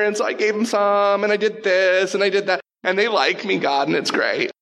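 high, breezy mock excuses with a laugh; excuse-making Saul. Preacher mocking Saul's excuses to God, high and breezy. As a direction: ham up Saul brushing off his failures.

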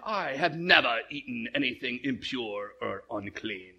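low, soft, badly faked British voice; bad British accent. Peter refusing unclean food, uppity bad British accent. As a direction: put on a stuffy British accent. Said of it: "a bad British accent".

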